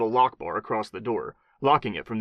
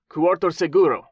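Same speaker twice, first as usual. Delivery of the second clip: repeating in Spanish; 7 semitones higher, 6 dB louder than usual. A narrator's reading.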